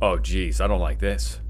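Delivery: low, hushed dread; uneasy. Real, not acted. Player creeping through the game, low uneasy voice. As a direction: say it small and low, full of unease.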